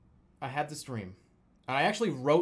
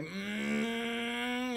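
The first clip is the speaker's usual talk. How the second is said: long high hum of a jet closing in; imitated jet drone; 8 semitones higher, 5 dB quieter than usual. Telling friends how the jet screamed by, imitated drone. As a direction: hold a high humming engine whine. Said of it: "it's just like".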